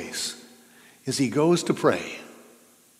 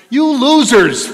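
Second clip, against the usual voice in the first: loud, high mock sneer; mock the sleepy disciples with contempt; hammed-up condemnation; scornful jeer; 11 semitones higher, 11 dB louder, slower than usual. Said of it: "do you hear him saying to them".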